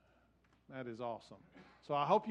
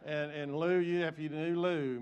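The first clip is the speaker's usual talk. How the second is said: normal talking voice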